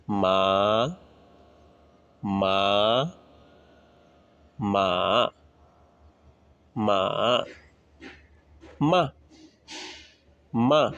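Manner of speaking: মা said in each Mandarin tone in turn, level, rising, dipping, falling; walk মা through the tones. Shown as a demonstration. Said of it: "আমরা একটা initials দিয়ে দেখি".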